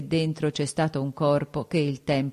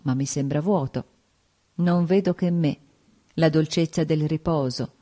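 usual speaking voice

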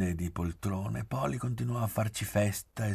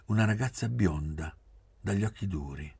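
usual speaking voice

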